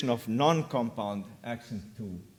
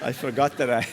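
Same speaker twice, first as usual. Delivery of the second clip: laughing; 4 semitones higher, faster than usual. Real, not acted. Laughs at clip mix-up.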